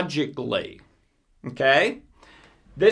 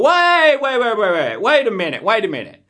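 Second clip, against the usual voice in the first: sudden self-interruption; 3 semitones higher, faster than usual. Pretend. Speaker stopping his own fearful thought, startled wait. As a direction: catch yourself mid-panic. Self-talk catching the fear.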